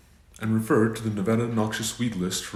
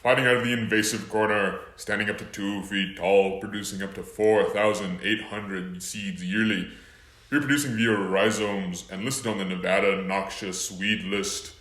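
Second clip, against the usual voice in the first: boxing-match fighter introduction; ring announcer. Acted.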